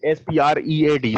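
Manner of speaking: loud, slow letter-by-letter spelling; spelled out. Shown as a demonstration. Teacher spells the word for students, loud and measured letter by letter. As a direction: spell it out loudly, each letter separate. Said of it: "इसको speed नहीं पढ़ते".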